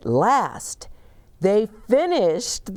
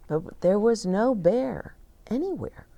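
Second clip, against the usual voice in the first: plain reading voice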